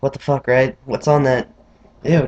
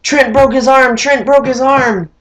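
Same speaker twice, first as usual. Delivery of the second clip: much higher "broke his arm", slowing; frantic phone call; 10 semitones higher, 4 dB louder than usual. Retold or imitated. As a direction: blurt it to the mom in alarm. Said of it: "he's like".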